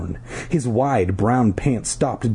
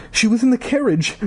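trembling voice; 13 semitones higher than usual. A narrator's reading. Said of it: "in a trembling voice".

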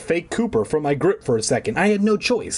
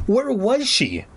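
usual speaking voice

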